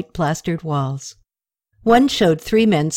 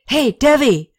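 raised call across a noisy tavern; 7 semitones higher, 4 dB louder than usual. Acted. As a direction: call him over, high and loud. Friend calling across tavern.

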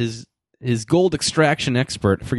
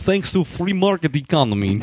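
mock Eastern European accent; 5 semitones higher than usual. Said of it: "I can't do this voice at all".

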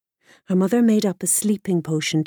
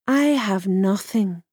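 ordinary delivery, nothing marked

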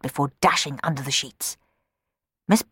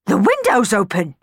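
louder startled cry; sudden exclamation; 4 semitones higher, 6 dB louder than usual. Acted. Miss Baggett spotting the open window, startled exclamation. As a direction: blurt it out in shock. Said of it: "she exclaimed suddenly".

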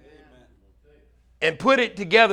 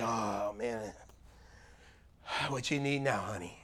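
much lower, much softer put-upon groan; mock weary groan; 11 semitones lower, 15 dB quieter than usual. Pastor mocks a husband tired of serving his wife, weary groan. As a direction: drag it out like serving is a chore. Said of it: "It's not like".

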